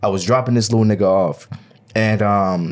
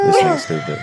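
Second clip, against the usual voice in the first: ordinary delivery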